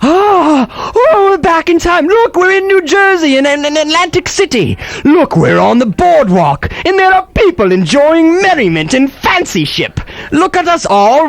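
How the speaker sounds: put-on gushing announcer whisking listeners to old Atlantic City; excited time-travel narrator. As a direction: sweep them back in time like a showman. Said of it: "transport yourself there".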